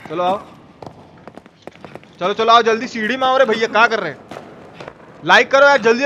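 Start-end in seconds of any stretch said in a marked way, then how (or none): none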